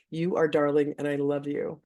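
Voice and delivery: usual speaking voice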